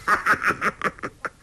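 high, staccato villain's guffaw; long rattling stage laugh; 哈哈大笑. A narrator's reading. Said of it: "欧阳锋哈哈大笑". (高声连串大笑)

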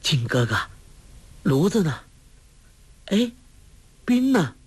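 a touch of dazed questioning; lightly voiced girl just coming round; waking girl's low cry. A narrator's reading. Girl waking and calling to her companion, mildly bewildered. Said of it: "黄蓉一声低呼".

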